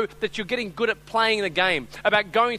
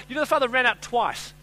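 ordinary delivery

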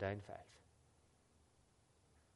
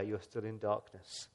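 softer and slow on "you're still in darkness"; quiet; 5 semitones lower, 8 dB quieter than usual. Real, not acted. Preacher to congregation, softly and slowly. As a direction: let "darkness" sink low and slow. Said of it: "If the light of the world is not there".

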